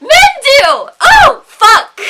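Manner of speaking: very high, full-volume outburst; outraged shouting. Real, not acted. Woman exploding over how men stare, outraged shout. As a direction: yell it out in utter exasperation.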